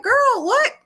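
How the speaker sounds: high, loud mock disbelief; put-on incredulous squawk; imitating baffled mother. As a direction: blurt it in high-pitched bafflement. Mother reacting to the breakdown.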